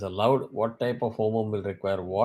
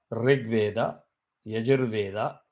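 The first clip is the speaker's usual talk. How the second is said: ordinary delivery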